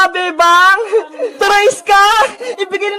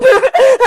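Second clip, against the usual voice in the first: high, quick giggle; delighted giggle; 6 semitones higher, 3 dB louder than usual. Acted. Girls delighted by parcel from YouTube, high giggle. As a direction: burst of high-pitched laughter.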